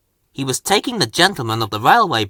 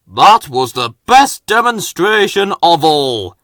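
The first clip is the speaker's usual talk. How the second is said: louder, pleased voice; cheerful Fat Controller; 4 semitones higher, 5 dB louder than usual. A narrator's reading. Boss cheerfully praising Harvey, a touch louder. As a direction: beam with pride. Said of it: "said the Fat Controller cheerfully".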